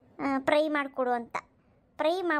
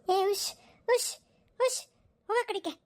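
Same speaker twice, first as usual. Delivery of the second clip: shooing a crow; 8 semitones higher than usual. Acted.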